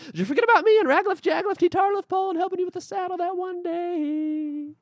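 high, fast, loud hen voice; hen calling out. Acted. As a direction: squawk it out as the hen. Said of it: "and called out".

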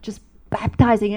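normal talking voice

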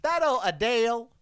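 hammed-up Southerner saying a name; playful Southern twang; Southern accent. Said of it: "Sort of like a Southerner".

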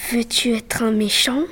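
sinister whispered question. Acted. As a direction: breathe it like a tempting shadow. The shadow tempts Henri.

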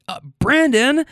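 high mock-exasperated call; bitching wife. Wife calling her husband to help her out, mock nagging. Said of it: "she's kinda bitching at him".

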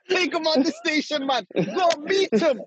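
high-pitched, hammed-up Jamaican accent; amused, mock-indignant Jamaican voice; old Jamaican man. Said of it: "old Jamaican guy, he's like".

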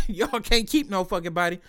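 ordinary talk

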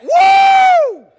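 huge high cheering whoop; dugout cheer. Retold or imitated. Preacher reliving the team cheering a ball. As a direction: whoop like a home run.

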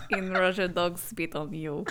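deadpan stage Russian joke; Russian accent. Pretend. Another dog joke in a mock Russian accent. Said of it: "I wish I could do a Russian accent".

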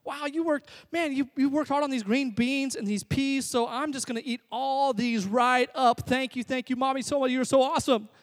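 hammed-up little-kid thanks to a parent; mock grateful toddler. Imagined two-year-old thanking Mom for green beans, mock gushing. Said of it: "look at this food and say".

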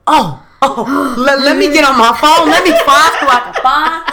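high, loud, flustered eagerness; mock excited boyfriend. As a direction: act out a guy scrambling excitedly for his phone. Boyfriend's excited reply acted out.

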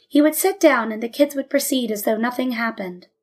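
ordinary delivery, nothing marked

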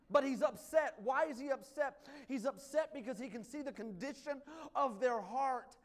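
full-force preaching. Acted. Pastor preaching hard to the congregation about Jesus' anger.

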